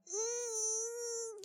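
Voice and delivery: high, soft stretching hum; stretching groan. Real, not acted. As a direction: groan through a big stretch. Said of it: "sorry, I'm stretching".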